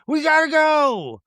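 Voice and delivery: high, slow, drawn-out mock fright; mock panic. Meredith fleeing the woods, hammed-up fright. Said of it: "Meredith is going".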